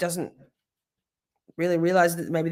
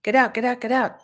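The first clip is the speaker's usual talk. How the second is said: raised, higher shooing voice; 6 semitones higher than usual. Real, not acted. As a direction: shoo them out. Shooing someone away, raised voice.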